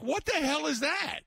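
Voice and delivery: a high, appalled what-the-hell; disbelieving. Retold or imitated. Customer handed a shrunken blizzard, appalled disbelief. Shown a sad-looking blizzard.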